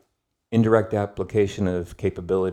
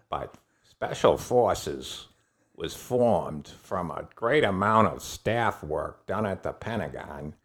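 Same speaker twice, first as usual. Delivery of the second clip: Aaron Bank; 4 dB quieter than usual. Acted. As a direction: put on the colonel's accent, slowing down. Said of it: "I'll do my Aaron Bank voice".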